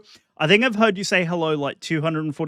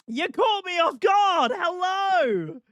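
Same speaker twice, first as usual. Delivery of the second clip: put-on playful gamer girl headset; 13 semitones higher than usual. Repeat in high squeal.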